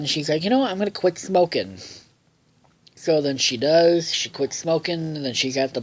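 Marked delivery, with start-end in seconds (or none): none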